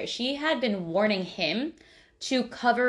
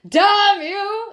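much higher, much louder mock shout; yell; 12 semitones higher, 10 dB louder than usual. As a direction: bellow it as a warning. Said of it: "he yelled".

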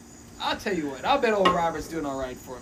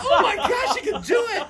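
mind blown; 14 semitones higher than usual. Retold or imitated. Storyteller as a boy watching kitten hypnosis, mind blown. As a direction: blurt it out high, utterly amazed. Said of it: "my mind is blown".

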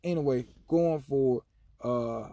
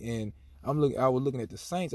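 ordinary talk, nothing marked

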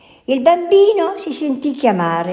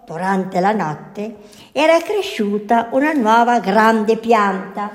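ordinary delivery

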